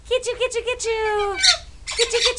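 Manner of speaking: sing-song high "get you" chase game; playful high teasing. Pretend. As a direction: tease the bird in a squeaky high voice. Teasing game with pet bird.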